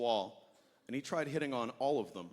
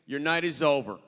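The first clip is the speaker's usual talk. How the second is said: firm bouncer; 2 semitones higher, 6 dB louder, slower than usual. Retold or imitated. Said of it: "He's like".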